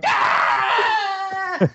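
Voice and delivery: high, drawn-out mock scream; imitated group yell. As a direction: yell "ah" without full volume. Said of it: "Yelling at the top of our lungs".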